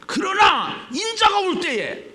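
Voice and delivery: high, loud, slow proclamation; preacher's proclamation. Real, not acted. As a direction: call the verse out forcefully. Preacher proclaims the verse again.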